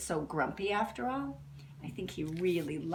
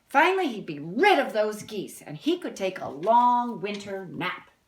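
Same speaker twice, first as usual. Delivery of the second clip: storybook reading; 2 semitones higher, 5 dB louder than usual. A narrator's reading. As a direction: read it like a picture book to kids. Reads the book text.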